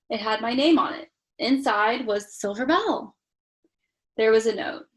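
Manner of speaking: steady storybook reading voice; story narration. A narrator's reading. Parent reading Polar Express to child, storytelling. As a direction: read it like a bedtime story.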